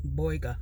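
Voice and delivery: put-on "boyga" with an OY vowel, no r; niece's pronunciation. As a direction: imitate the niece saying "boyga" as BOY-guh. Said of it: "Or as my niece says".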